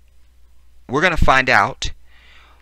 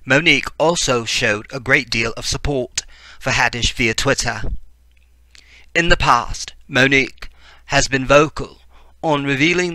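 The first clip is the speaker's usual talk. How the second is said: mock BBC newsreader in a put-on British accent; fake British accent; 2 semitones higher than usual. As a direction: read the story out with a playful fake British accent. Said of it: "let's just do British".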